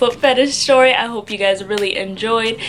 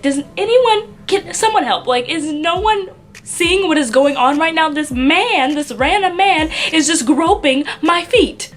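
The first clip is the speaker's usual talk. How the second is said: higher, incredulous appeal for help; mock alarmed plea; 6 semitones higher than usual. Looking around while a stranger gropes her, mock alarm. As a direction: act out mild panic at being ignored.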